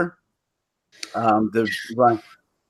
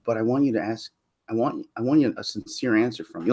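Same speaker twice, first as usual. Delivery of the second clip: softer, earnest request; serious; 2 semitones lower, 7 dB quieter than usual. Retold or imitated. Man asks a female friend, soft and serious. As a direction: go quiet and really serious. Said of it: "you get really serious".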